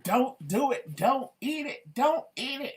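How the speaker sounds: high, loud pleading scream; imitating a friend screaming in disgust; retold screaming. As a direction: yell at the screen to stop someone eating. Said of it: "He was screaming".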